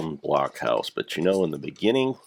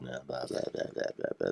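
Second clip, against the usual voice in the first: a hint of a soft, breathy TV host, high and much softer; mock Mister Rogers; 9 semitones higher, 8 dB quieter, faster than usual. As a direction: mumble it like a gentle kids' show host, mocking. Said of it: "That soft voice that he always used".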